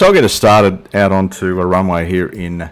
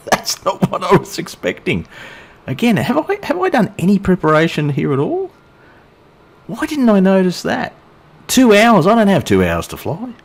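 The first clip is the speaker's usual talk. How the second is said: high-pitched, incredulous protest; dismayed disbelief; 11 semitones higher than usual. Real, not acted. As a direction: pitch leaps up in real surprise at the bad news. Discovers flight takes two hours.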